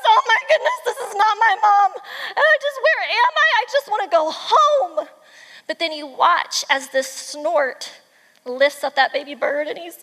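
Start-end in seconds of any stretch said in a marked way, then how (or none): 0.0-5.1 high, loud hammed-up panic; freaking out. Baby bird finds this is not mom, acted panic. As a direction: squeal it like a frightened little bird in a picture book. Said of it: "he's freaking out".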